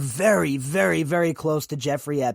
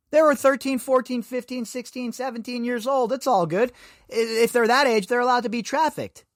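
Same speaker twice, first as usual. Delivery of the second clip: sarcastic mock defense; 6 semitones higher than usual.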